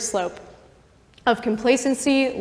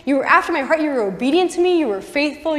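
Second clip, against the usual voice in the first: higher-pitched, exasperated pleading; acted dismay, pitched up and easing off; upset God; 5 semitones higher than usual. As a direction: play a let-down God reproaching his favorite. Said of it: "God is so upset".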